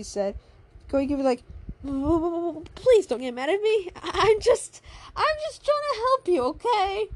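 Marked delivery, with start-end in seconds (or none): 1.8-7.1 high, stuttering plea; blushing, tripping over the first words; flustered stutter. Acted. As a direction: stammer it out, embarrassed and pleading. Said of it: "In a blushed way, but stuttered".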